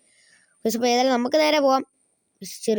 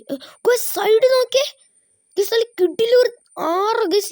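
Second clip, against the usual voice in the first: excited; 11 semitones higher, slower than usual. Real, not acted. Spots a river while driving.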